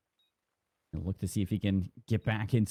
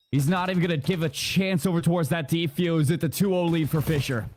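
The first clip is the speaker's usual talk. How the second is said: driving esports shoutcast delivery; live play-by-play; 7 semitones higher, 4 dB louder than usual. Real, not acted. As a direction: call the clutch as it happens.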